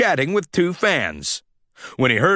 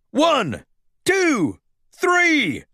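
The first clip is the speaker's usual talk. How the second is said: referee counting; 10 semitones higher, much slower than usual. Acted. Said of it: "the referee counted".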